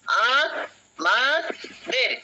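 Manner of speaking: আ, মা, দের split into three pushed beats; teacher counting the syllables of আমাদের. Shown as a demonstration. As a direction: tap out আ মা দের one push per syllable. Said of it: "কবার ধাক্কা লাগছে দেখো তো একটু".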